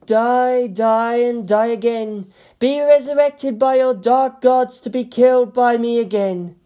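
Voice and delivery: fierce shouted threat; furious battle cry. A narrator's reading. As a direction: vow to kill again and again.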